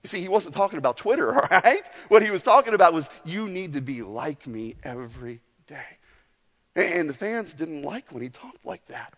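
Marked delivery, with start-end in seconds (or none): 3.3-6.0 hushed Jesus words. Retold or imitated.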